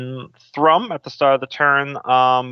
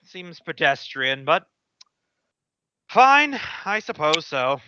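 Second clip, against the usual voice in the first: sighing reluctance; 3 semitones higher, slower than usual. Acted. Alchemist grudgingly agreeing to the drow lady, sighing. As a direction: give in with a weary, put-upon air. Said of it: "he sighs and goes".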